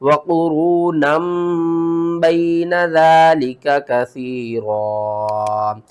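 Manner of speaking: slow chanted verse; Quran recitation. Shown as a demonstration. Teacher models iqlab, slow recitation. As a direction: recite slowly with the noon turned to meem. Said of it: "इकलाब करेंगे".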